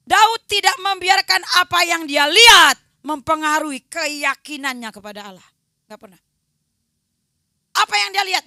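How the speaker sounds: loud preaching to the congregation; full-force preaching. Acted. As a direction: drive it home to the church.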